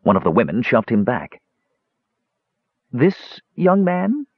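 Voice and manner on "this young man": high, slow, incredulous question; high questioning lilt; dawning realisation. A narrator's reading. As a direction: draw it out as it dawns on you. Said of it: "the dark-haired beauty suddenly caught on".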